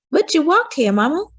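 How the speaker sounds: put-on a little boy poking at his mother's logic; child's voice. A narrator's reading. Frederick challenging Mama about the walk, high child voice.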